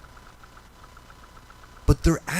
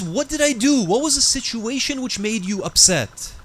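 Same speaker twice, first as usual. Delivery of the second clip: alarmed question; 7 semitones higher than usual. Retold or imitated. Son shocked by mother.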